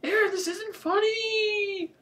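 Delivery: very high whine, end drawn out; high squealed complaint; breathless cry. A narrator's reading. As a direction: wail it in protest, far above your pitch. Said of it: "I cried, still out of breath".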